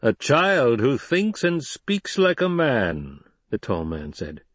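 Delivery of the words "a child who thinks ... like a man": slow, higher-pitched character voice. Acted. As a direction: weigh up the boy, drawn out. Tall man marvels at boy.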